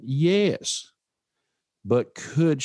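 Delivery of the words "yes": higher-pitched excited "yes"; an acted excited answer, not shouted; retold excitement. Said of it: "with great excitement".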